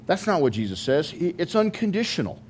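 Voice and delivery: ordinary talk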